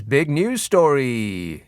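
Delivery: slow, drawn-out big news story; mock Gove drawling big news story. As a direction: stretch every word out slowly. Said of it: "And he said it like that".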